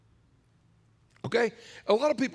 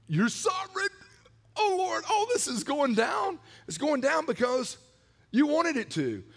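much higher, earnest pleading prayer; praying disciples; 7 semitones higher than usual. Retold or imitated. Preacher voicing disciples praying to God. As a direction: cry out like the early believers. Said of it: "That's where they were at".

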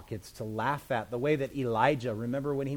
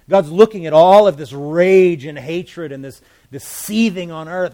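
loud and raised, full force; fervent preaching; 4 semitones higher, 15 dB louder than usual. Real, not acted. Preacher thundering about the rage against God. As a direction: pour on the fire.

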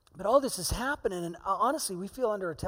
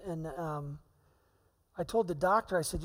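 ordinary talk, nothing marked

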